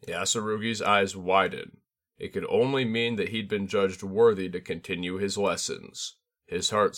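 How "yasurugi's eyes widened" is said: ordinary delivery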